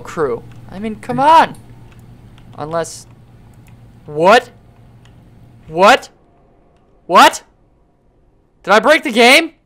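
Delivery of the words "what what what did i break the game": rising "what?", louder each time; escalating baffled questioning; mounting disbelief. Real, not acted. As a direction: build from puzzled to loud on each "what?". Baffled by game glitch.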